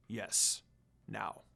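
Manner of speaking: low, soft, cool command; a calm, quiet demon lord; Sesshomaru voice. Acted. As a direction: Sesshomaru deciding to rescue Rin, low and quiet.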